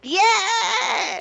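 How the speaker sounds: high, loud whoop of a yes; cheering scream. Acted. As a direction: scream it from the back of the crowd. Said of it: "Screaming in the background".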